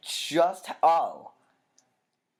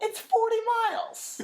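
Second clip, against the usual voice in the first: very high-pitched mock outcry; squealed protest. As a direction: squeal it in imitation of an outraged complaint. Acted outraged protest from the show.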